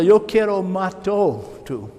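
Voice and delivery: ordinary talk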